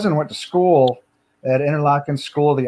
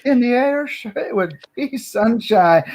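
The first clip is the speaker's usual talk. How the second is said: joke telling voice; 7 semitones higher than usual. Retold or imitated. Retold Redd Foxx joke.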